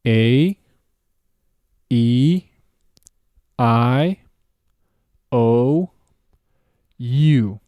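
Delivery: long, gliding English vowels, loud and drawn out; English A, E, I, O, U with rounded, flared endings; exaggerated English vowels. Shown as a demonstration. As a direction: stretch each English vowel with a glide. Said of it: "say them in English with a little bit of an exaggeration".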